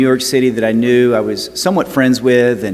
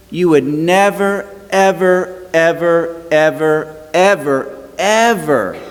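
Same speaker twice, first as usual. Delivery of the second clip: pitched up, hammering each ever; emphatic repetition; 5 semitones higher, slower than usual. Acted. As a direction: hammer every ever, rising then settling.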